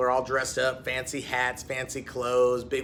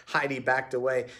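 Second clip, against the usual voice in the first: plain reading voice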